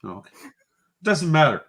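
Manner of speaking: normal talking voice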